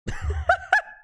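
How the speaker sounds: high squealing laugh burst; burst of laughter. Real, not acted. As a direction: crack up suddenly, way up high. Host cracks up at joke.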